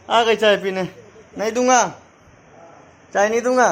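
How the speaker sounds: high, loud sing-out refusal; playful teasing. Real, not acted. Dad refusing the child tea, playful teasing.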